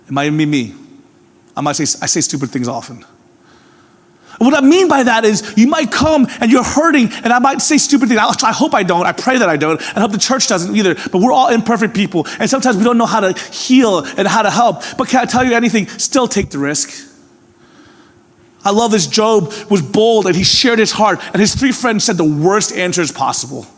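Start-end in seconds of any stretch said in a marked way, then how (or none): 4.4-16.9 rising pulpit fervor; fervent preaching. Acted. Preaching at full force. 18.6-23.7 rising pulpit fervor; fervent preaching. Acted. Preaching at full force.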